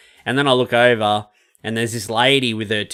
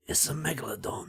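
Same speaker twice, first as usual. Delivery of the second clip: hushed English action-hero impression; Jason Statham; 3 semitones higher, 16 dB quieter than usual. On request repeating the submarine line, hushed tough-guy impression. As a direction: say it very softly, like a grave trailer line. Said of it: "only Jason Statham impression I can do".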